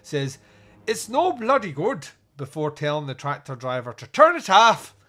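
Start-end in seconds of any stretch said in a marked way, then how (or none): 0.8-2.1 high, loud mock-Irish farmer; terrible Irish accent. Useless middleman farmer to his colleagues, in bad Irish. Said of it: "my terrible Irish accent". 4.1-5.0 hammed-up Irish shout; terrible Irish accent. Farmer telling the tractor driver, shouted in bad Irish. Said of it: "my terrible Irish accent".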